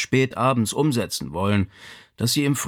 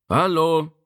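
raised voice, calling into the house; 6 semitones higher than usual. A narrator's reading. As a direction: call out a higher-pitched hello. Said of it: "rief er".

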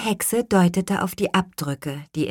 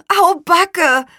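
high, loud dismayed exclamation; startled, high-pitched call; 14 semitones higher, 8 dB louder than usual. A narrator's reading. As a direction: cry out like an alarmed boy. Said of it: "rief Luis".

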